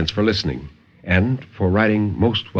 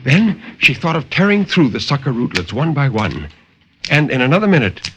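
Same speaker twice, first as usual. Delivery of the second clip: dramatic story narration. A narrator's reading. Narrator reading a horror tale to radio listeners, dramatic and intense. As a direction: tell the horror tale with rising urgency, then settle.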